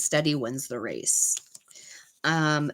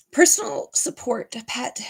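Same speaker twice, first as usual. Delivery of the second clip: teary whisper; 6 semitones higher than usual. Real, not acted. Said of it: "I'm gonna cry".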